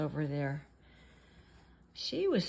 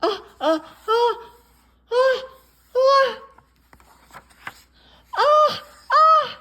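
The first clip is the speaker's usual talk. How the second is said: ever higher, louder uh, uh gasps; rising sneeze build-up; 13 dB louder than usual. Acted. Gerald's biggest sneeze about to burst. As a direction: climb the sneeze to a peak.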